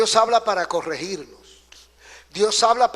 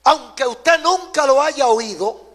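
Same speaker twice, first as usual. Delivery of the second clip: raised, louder preacher's voice; emphatic preaching; 5 semitones higher, 7 dB louder than usual. Real, not acted. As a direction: proclaim it forcefully to the congregation. Preacher's emphatic build before refrain.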